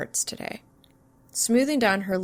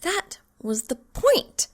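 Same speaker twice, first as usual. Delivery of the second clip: hiss; 10 semitones higher, slower than usual. Acted. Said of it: "she hisses".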